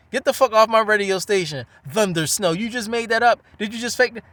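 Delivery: imitating an indignant radio man, loud; mad radio man. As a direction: angrily throw a caller off the air. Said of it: "He was mad as shit".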